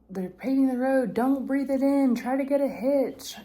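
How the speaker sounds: loud, high mock holler; imitated called-out warning. Nice man warns hiker about paving, imitated loud holler. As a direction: imitate a driver shouting advice. Retelling driver's warning from his car.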